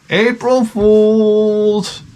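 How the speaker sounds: high, very slow singsong April Fool's; mock teasing. Dad teasing his son back, high and slow. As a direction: tease back in kid style. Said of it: "I'm like".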